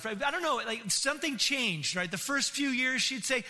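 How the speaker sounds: usual speaking voice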